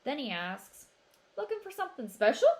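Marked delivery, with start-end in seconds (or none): 1.4-2.5 higher, louder and fast, suddenly keen; greedy shopkeeper perking up; eager sales pitch. A narrator's reading. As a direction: pounce on a buyer.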